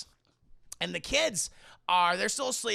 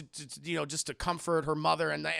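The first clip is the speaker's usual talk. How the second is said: ordinary talk, nothing marked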